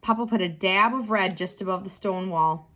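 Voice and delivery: ordinary delivery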